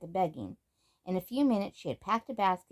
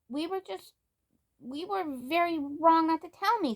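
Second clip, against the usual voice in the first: put-on much higher, flustered little-girl voice; 10 semitones higher than usual. Samantha owns up to Grandmary, high flustered voice. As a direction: stumble to own up.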